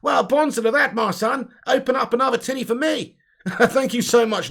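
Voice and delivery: high, loud cartoon Australian; over-the-top mock-Australian cheer; bad Aussie accent. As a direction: ham it up as an Aussie mate. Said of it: "shit Aussie accent".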